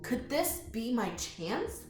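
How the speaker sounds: usual speaking voice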